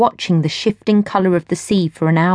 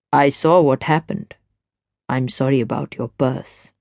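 lower, easy man's voice; smooth stranger; 6 semitones lower than usual. A narrator's reading. Stranger chats to Gwen at the rail, low and easy. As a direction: speak low and relaxed, at ease.

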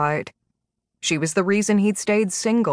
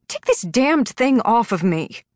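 higher, indignant captive's demand; sharp, angry outburst in a higher voice; 5 semitones higher than usual. A narrator's reading. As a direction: order your captor to free you, furious. Glowering captive demands collar removed.

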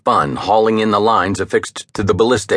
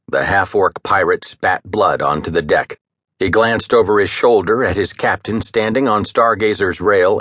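plain reading voice

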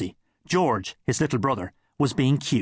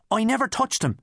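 higher, quick denial; defensive protest; 10 semitones higher than usual. Acted. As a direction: insist you did nothing. Boy protests innocence.

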